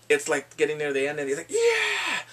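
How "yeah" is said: high, drawn-out "yeah"; hammed-up high yell; mock triumphant cheer. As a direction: cheer like the winner. Retold cheer near game's end.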